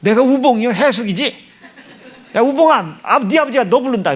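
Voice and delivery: high, loud, mock-indignant mother; mother's annoyed retort. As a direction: voice his riled-up mother snapping back at dad. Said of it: "약 올라가지고". (약 오른 엄마 흉내, 높고 크게)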